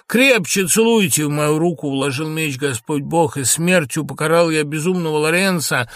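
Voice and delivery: high, loud, anguished declamation; impassioned outburst. Acted. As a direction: proclaim it with desperate fervour. Lorenzo's anguished outburst over the dead.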